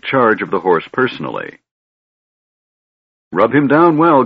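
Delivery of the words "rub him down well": louder, higher order to the stableman; commanding order. A narrator's reading. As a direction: bark the order across the yard. Lord ordering stableman about horse.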